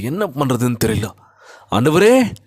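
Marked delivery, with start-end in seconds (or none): 1.7-2.3 raised, louder cry to the Lord; acted plea, voice lifted; calling out to God. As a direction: call out higher, pleading to God. Crying out to God.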